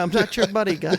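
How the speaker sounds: high mock Canadian line through a laugh. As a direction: giggle through the Canadian comeback. Trading the Canadian joke line.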